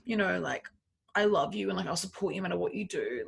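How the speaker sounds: hushed, coaxing impression of an ex; soft sweet-talk. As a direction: imitate a boyfriend softly sweet-talking. Acting out ex's sweet-talking.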